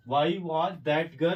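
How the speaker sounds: drawn out word by word; slow dictation. Shown as a demonstration. Teacher dictating to students, very slow. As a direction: give each word its own beat. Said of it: "उसके बाद main verb".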